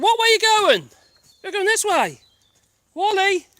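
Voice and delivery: high, loud shout across open ground; calling the dog. Real, not acted. Dog owner calls Wally who is going off, high loud calling. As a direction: holler after a straying dog.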